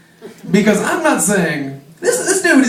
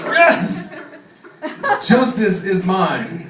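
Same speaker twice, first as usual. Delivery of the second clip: mock triumph; much slower than usual. Gloating at revenge.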